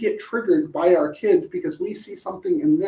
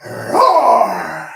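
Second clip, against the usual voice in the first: playful roar; 8 dB louder than usual. Pretend. Song ends with a roar.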